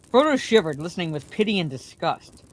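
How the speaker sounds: ordinary delivery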